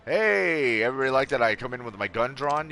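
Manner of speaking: loud, fast, playful; excited. Real, not acted. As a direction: burst in with a grin. Joking to chat.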